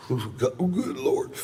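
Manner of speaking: high, laughing rave over the scotch; delighted gush. Retold or imitated.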